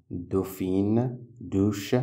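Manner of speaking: ordinary talk, nothing marked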